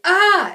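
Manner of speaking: sudden high yelp; short high mock shriek; mock scream. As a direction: yelp like a panicked kid. Kid screaming in retold story.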